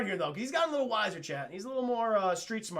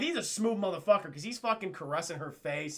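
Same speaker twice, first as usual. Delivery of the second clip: ordinary delivery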